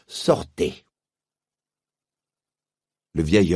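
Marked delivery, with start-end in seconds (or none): 0.1-0.8 a high, loud, imperious witch laying a curse; haughty witch casting a curse, then dismissing him; menacing witch. Acted. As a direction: threaten him like a cold sorceress queen. The witch Jadis threatens the uncle.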